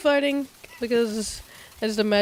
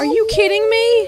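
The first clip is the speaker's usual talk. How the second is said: high, loud outburst; real exasperation; 12 dB louder than usual. Real, not acted. Player protesting to the game, loud exasperated outburst. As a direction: cry out in high-pitched disbelief.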